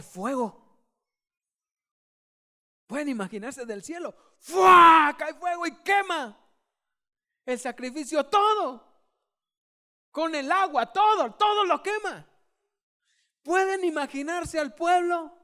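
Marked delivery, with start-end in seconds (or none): 4.4-5.2 a big, high, loud whoosh of fire; shouted fire whoosh. Acted. Preacher booms the fire striking the altar with a shout. 5.2-12.3 excited preaching. Acted. Dramatic fire narration.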